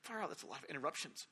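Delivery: breathy, near-silent murmur; whispered aside. Retold or imitated. Preacher marvelling at the interruptions, whispered. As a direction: let it slip under your breath.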